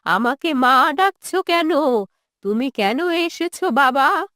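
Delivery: put-on drawn-out, quavering old mother voice. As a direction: answer as a wary old mother, words stretched.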